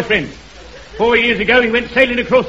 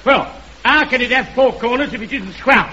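ordinary talk

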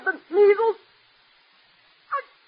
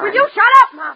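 angry outburst; 3 semitones higher, 6 dB louder than usual. Acted. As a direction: lash out in frustration. Daughter snaps at nagging mother.

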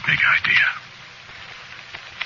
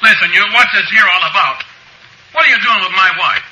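high, raised-voice outburst; outraged protest; 5 dB louder than usual. Acted. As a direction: break in to defend your wife. Husband jumps up, protests in court.

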